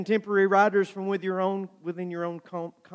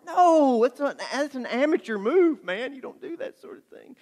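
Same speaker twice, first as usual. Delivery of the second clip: mock exasperation; 9 semitones higher than usual. Author rejecting an amateur move, mock-exasperated. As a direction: object as if the idea is mortifying. Acted scornful answer to Cindy.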